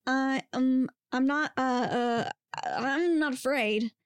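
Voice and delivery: slow, stammering denial; halting, flustered. A narrator's reading. Frightened student facing Bigfoot, halting and flustered. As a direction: drag each word out with effort. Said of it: "horribly hard to extract from her brain".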